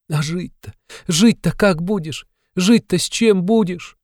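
put-on high, pleading young woman; desperate Sonya. A narrator's reading. Sonya pleading with Raskolnikov, desperate. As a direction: beg him, voice climbing.

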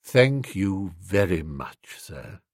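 low, slow servant's voice; deferential valet. A narrator's reading. Valet accepting the cast-off garment, deferential. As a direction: thank him slowly, sinking very soft on the title. Valet thanking his master.

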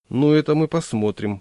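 usual speaking voice

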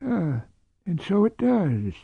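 put-on bemused old man taking note. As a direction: agree as if pleasantly puzzled. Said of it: "he said, sounding bemused".

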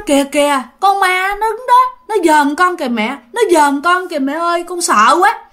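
put-on a child pointing at a ghost, voice lifted in mild fear; lightly acted scared kid begging for mom; frightened child. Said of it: "nó nói".

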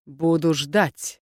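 plain reading voice

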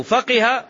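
فقه said "faqiha", i after the qaf; kasra on qaf. Shown as a demonstration. Teacher spelling out the vowel, فقه pronounced "faqiha". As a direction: give فقه a clear "qi" middle syllable. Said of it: "بكسر القاف".

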